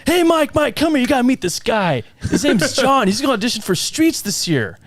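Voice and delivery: high-pitched excited introduction; imitating Gary. Veteran actor introducing a newcomer to a colleague, excited impersonation. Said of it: "and Gary's like".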